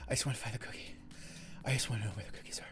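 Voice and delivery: fast, breathless whisper of craving; mock whisper. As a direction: hiss it out like a cookie fiend. Whispered cookie longing.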